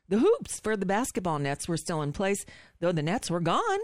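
mock upspeak reading, phrase endings lifted like questions; Nicole Wallace. Host reads novel aloud to mock anchor, upspeak reading. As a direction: read measured, pausing on questioning rises. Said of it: "read in, uh, Nicole Wallace style".

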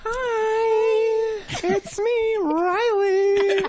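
man's very high falsetto lady voice; sexy lady voice. Pretend. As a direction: squeak it out high and flirty. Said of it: "You just do a sexy lady voice".

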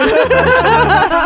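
loud squealing laugh; high burst of laughter. Real, not acted. Host laughing hard at own joke about stupidity.

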